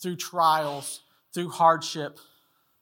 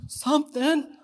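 high imitated little-kid answer; mock toddler reply; 10 semitones higher, slower than usual. Believers answer the Lord like the two-year-old, in mock toddler voice. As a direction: answer high like a clueless small child.

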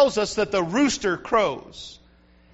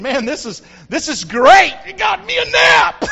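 loud, higher sarcastic glee; mock cheer; 6 semitones higher, 10 dB louder than usual. [name] pretending he's glad he got a nap, loud mock cheer. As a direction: ham up a happy sleeper.

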